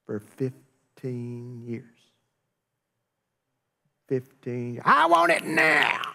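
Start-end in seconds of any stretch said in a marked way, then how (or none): 4.8-6.0 high, drawn-out mock tantrum; impatient demand. Preacher mimics someone refusing to wait, impatient demand. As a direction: demand it high and stretched, hammed-up.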